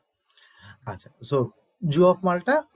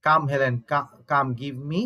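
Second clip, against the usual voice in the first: slow recitation; much slower than usual. Acted. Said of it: "এই কথাটা তখন doctor Faustus বলে".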